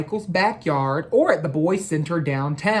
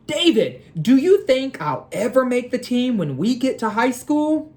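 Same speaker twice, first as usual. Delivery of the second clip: put-on higher, eager boy's voice; hopeful kid; 6 semitones higher than usual. Michael asking his friend David about making the team, eager and higher. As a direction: ask it like a hopeful young boy. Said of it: "Michael asks David".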